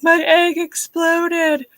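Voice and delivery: high, drawn-out mock wail; retold tearful whine, stretched out; imitated crying. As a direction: wail it like a hammed-up sob story. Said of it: "I'm sitting here crying".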